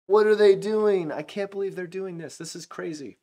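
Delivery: stunned onlooker asking what on earth they're doing; appalled disbelief. Retold or imitated. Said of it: "you go".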